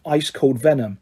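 ordinary delivery

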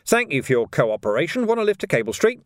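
breezy offer, lifting high on the question; lightly chipper policeman; mildly cheerful. A narrator's reading. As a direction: thank him with light, chirpy courtesy. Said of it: "he said cheerfully".

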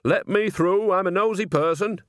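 put-on high, loud, cheeky old nanny; pushy old woman. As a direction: elbow through the crowd, loud and cheerful. Nanny pushing through the crowd.

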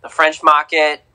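French Market in an accidental Boston accent. Real, not acted. Co-host listing New Orleans sights, slipping into a Boston accent. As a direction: slip into a Boston accent, dropping the R. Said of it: "I just did a Boston accent".

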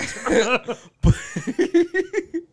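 laughing hard, pitched high; a high cackling laugh; high burst of laughter. Real, not acted. Big laugh.

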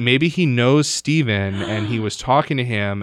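imitated grandma, loudly working out an excuse; insistent grandmother. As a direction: play a grandmother stubbornly reasoning it out, loudly. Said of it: "And she's like".

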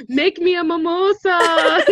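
slow, high, through laughter; laughing. Real, not acted. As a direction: squeak it out mid-laugh. Laughing request.

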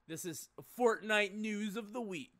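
slow, hammed-up segment intro; news announcer voice. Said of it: "That's not gonna be a bit".

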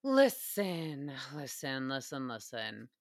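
listen fading to a near whisper; listen trailing away to almost nothing; fading repeats. Pretend.

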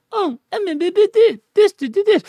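very high mock stutter; mock a bumbling newsreader; stammering newsreader. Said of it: "People read the news like".

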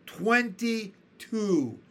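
outraged, correcting the liar; shrieking earthworm. A narrator's reading. Earthworm corrects the centipede's count, shrieking in outrage. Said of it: "shrieked the earthworm".

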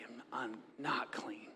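a hushed, trembling admission of unworthiness; whispered confession. Retold or imitated. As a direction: whisper it, ashamed and broken. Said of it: "he falls down and he says".

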